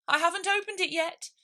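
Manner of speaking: high, flustered rabbit voice. Acted. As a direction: squeak it out in a hurry.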